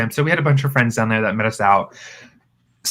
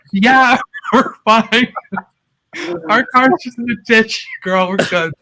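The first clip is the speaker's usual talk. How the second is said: put-on drunk giggling reassurance; 11 semitones higher than usual. To the worried couple, a high laughing drunken reassurance. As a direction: pretend all is fine while laughing.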